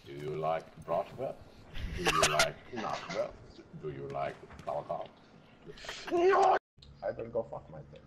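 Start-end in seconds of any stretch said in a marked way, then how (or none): 0.1-5.1 put-on low, rising German-accented questions. Teasing teammates about German food, in a low German accent. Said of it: "The German accent is on point". 6.1-6.6 high, loud shout. Acted. Refusing a teammate's question, with a sudden high shout. Sudden outburst mid-bit.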